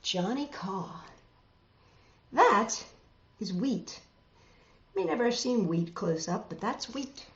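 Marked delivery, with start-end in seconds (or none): none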